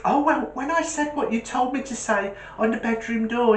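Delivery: put-on high child recounting to dad; small boy telling his story; little voice. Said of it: "the little voice goes".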